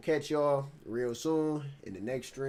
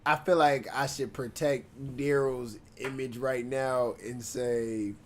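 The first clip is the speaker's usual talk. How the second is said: ordinary talk, nothing marked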